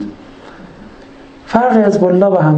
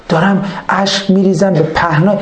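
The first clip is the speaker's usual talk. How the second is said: normal talking voice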